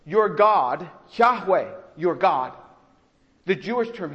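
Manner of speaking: ordinary talk, nothing marked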